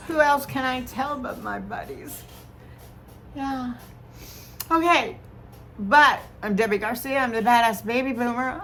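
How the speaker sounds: normal talking voice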